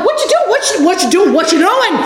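high, urgent alarmed calls to husband; panicked shout at a drifting driver; alarmed yelling. Retold or imitated. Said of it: "And I'm like".

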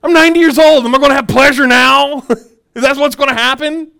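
hammed-up shocked old woman; old Sarah's disbelief. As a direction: play Sarah aghast at the news. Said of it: "she was like".

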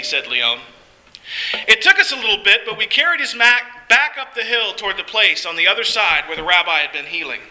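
ordinary delivery, nothing marked

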